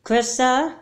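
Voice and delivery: loud call from another room. Acted. Mother calling her daughter from afar, loud. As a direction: call her name to find her.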